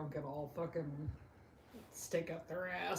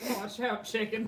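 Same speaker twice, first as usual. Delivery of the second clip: raised, louder shout; calling a warning; 6 semitones higher, 10 dB louder, slower than usual. Real, not acted. Warning a chicken about the hawk, loud high call.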